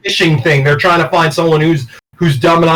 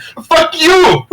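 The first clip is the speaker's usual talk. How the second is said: put-on high shouted Indian accent; 12 semitones higher than usual. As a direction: yell it in a mock Indian accent. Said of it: "in the fucking Indian accent".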